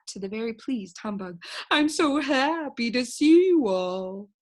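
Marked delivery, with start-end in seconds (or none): 1.7-4.3 slow, smooth friendly stranger; oily polite gentleman voice; amiable gentleman. Acted. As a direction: greet him sweetly, slowly.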